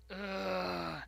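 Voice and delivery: loud, whiny groan of dread; mock groan. To grandma at her house, a whiny groan. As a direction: let out a pained ugh.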